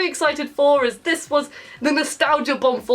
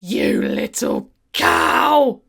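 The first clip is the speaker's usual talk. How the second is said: much lower, very slow and louder; mock insult; 8 semitones lower, 3 dB louder, much slower than usual. Real, not acted. Vlogger scolding a cutscene character, slow contemptuous insult. Insulting Nina over the shoe.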